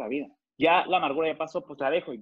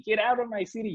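hysterical yell; 6 semitones higher than usual. Retold or imitated. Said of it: "bien histérico".

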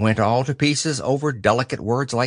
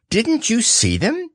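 high, rising owl question; a little surprised; 11 semitones higher than usual. A narrator's reading. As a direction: ask with mild surprise, high-pitched. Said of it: "a little surprised".

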